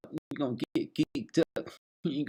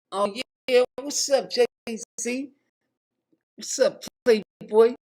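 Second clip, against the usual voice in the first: much higher, hyped-up host voice; 12 semitones higher, 6 dB louder than usual. Acted. [name] greeting his guest in a high, hyped squeal.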